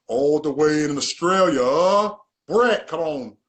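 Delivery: loud, slow, drawn-out hype call; hyped announcement. Real, not acted. Host introducing his Australian guest to viewers, hyped and drawn out. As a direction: stretch it out big like introducing a star guest.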